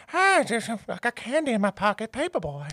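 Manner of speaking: put-on high, soft creepy old man voice; creepy neighbour voice, high and soft; creepy old man impression.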